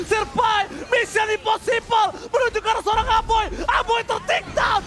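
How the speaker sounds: high, loud hype call; excited shout. Real, not acted. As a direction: shout the big play. Play-by-play climax, shouting.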